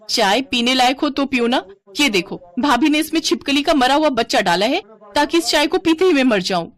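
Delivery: high-pitched outraged accusation; angry. Acted. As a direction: accuse her with wounded outrage. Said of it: "तू इतने गुस्से में क्यों है?". (गुस्से में, ऊँचे सुर में शिकायत)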